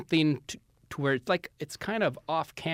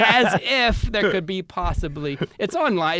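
laughing talk; 5 semitones higher, 6 dB louder than usual. Real, not acted. Laughing while talking.

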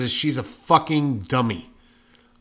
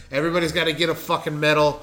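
ordinary delivery

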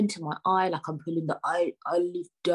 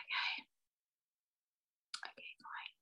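very soft, voiceless acceptance; whispered resignation; 16 dB quieter than usual. Retold or imitated. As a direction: concede in a bare whisper. Acts out giving in to optician.

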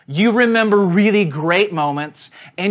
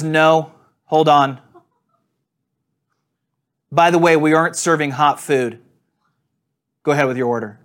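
rude; 4 dB louder than usual. Retold or imitated. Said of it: "stunning display of just rudeness".

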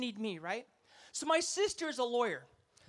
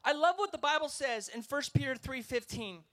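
ordinary delivery

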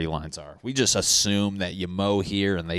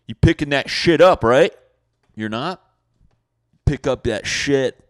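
loud, higher demand; yelling neighbor; 7 semitones higher, 10 dB louder than usual. Acted. Neighbor to a dog walker, loud demanding call.

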